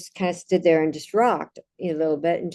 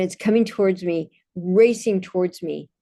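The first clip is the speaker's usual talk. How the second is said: usual speaking voice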